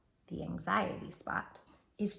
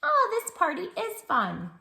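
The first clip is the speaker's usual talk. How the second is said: put-on cheerful child; 9 semitones higher, 5 dB louder than usual.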